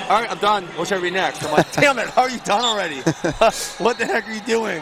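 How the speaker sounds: exasperated coach, mock disbelief; mock exasperation. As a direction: play the stunned coach, half laughing. Coach retells athlete asking for more.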